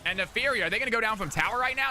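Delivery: excited caster calling a kill; live play-by-play. Acted. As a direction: build the call up to the kill. Esports play-by-play casting.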